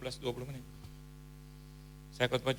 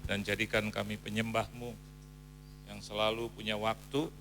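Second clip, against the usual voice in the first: closing prayer; 3 semitones lower than usual. Real, not acted. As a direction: lead the congregation in prayer.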